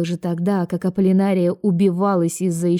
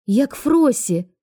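higher, drawn-out astonished explanation; surprised; 7 semitones higher, slower than usual. A narrator's reading. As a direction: explain it slowly, taken aback. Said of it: "удивилась Аполлинария". (удивлённо)

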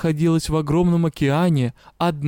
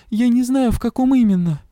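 high, distressed whine; tearful; 7 semitones higher, 4 dB louder than usual. A narrator's reading. Patient admitting to the witch she doesn't know, tearful. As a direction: plead helplessly in a raised, tearful voice. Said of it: "я всхлипнула".

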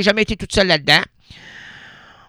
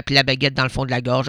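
put-on French accent; 5 semitones lower, 4 dB quieter, faster than usual. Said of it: "à la française, avec l'accent".

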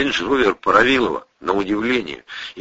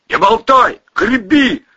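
high, loud barked order; shouting; 7 dB louder, slower than usual. Acted. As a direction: snap at him to row. Orders friend while swimming.

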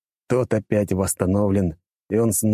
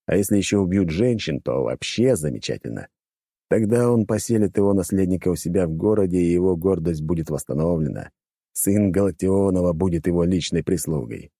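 ordinary delivery

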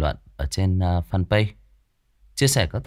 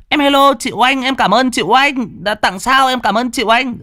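excited thanks; 8 dB louder than usual. Real, not acted. Thanking a viewer for gifts.

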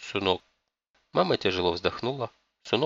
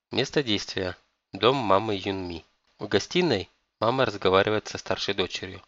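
ordinary delivery

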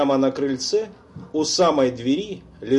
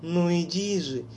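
put-on eerie girl's voice; 6 semitones higher than usual. A narrator's reading. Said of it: "звучал голос Лоры".

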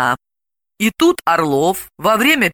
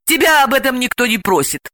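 higher, irritated retort; snappish; 7 semitones higher than usual. A narrator's reading. Delila answers her friend, snappish. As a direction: snap back briefly. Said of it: "огрызнулась Далила".

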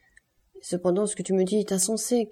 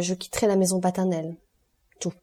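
usual speaking voice

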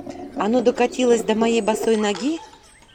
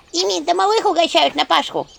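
imp chorus; 8 semitones higher, 4 dB louder than usual. Acted. Imps chiming in almost in chorus. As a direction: call it out together like imps.

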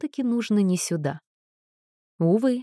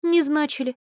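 cheerful agreement; 8 semitones higher than usual. A narrator's reading. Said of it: "весело согласилась Анна".

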